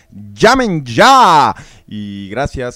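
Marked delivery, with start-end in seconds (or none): 0.1-1.5 slow, loud TV-sales shout; infomercial announcer. Acted. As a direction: sell it like a late-night ad. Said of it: "ya vieron el comercial".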